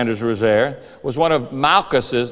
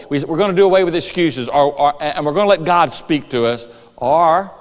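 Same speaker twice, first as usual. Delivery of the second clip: ordinary talk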